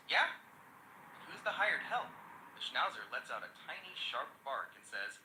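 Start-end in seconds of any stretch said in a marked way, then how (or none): 0.1-2.1 sneering question, higher pitched. A narrator's reading. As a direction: challenge with a raised, sneering question. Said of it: "The burglar sneers".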